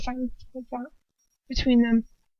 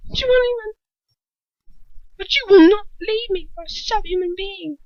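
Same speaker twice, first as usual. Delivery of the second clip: much higher, louder defiance; defiant rage; 9 semitones higher, 5 dB louder than usual. A narrator's reading. Bellatrix refusing to let Lucius leave her. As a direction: insist fiercely.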